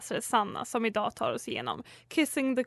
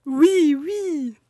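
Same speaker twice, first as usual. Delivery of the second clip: louder breathy French oui; French accent; 3 semitones higher, 7 dB louder than usual. Acted. Flight attendant seducing the doctor, French accent. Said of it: "du ska alltså ha fransk accent".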